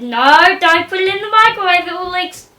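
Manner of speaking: a panicked shout of warning at the microwave; alarmed loud warning. Retold or imitated. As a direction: blurt it out to stop him in time. Pupsy warning about the microwave.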